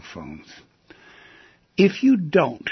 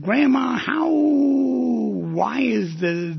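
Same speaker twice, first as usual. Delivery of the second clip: put-on high, drawn-out child's voice; grandchild asking; 9 semitones higher, much slower than usual. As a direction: play a curious little grandchild asking grandma. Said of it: "and a grandchild said".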